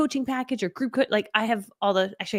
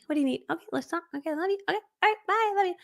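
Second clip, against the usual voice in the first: higher, breezy reassuring brush-off; sweet quick mom voice; 9 semitones higher, 5 dB quieter, faster than usual. Retold or imitated. Mom answers her kids quickly and lovingly. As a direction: sweetly send the kid off fast.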